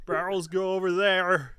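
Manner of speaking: lumbering cook, pointing the way; half Sloth, half Lenny. Acted. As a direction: say it like a big simple oaf. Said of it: "half Sloth and half Lenny from Mice and Men".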